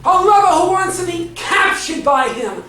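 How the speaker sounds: forceful, high sermon delivery; rousing pulpit cry; impassioned preaching. Real, not acted. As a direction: preach it loud, hammering each short phrase.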